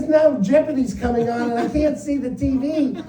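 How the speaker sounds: deadpan complaint with a laugh; wife ignoring husband for the TV; wife's voice. Retold or imitated. Wife's punchline.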